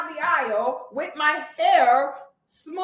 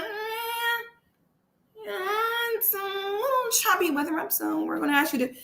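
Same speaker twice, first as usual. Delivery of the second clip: mock pilot voice; 5 semitones higher, 5 dB quieter than usual. Pilot quoted again.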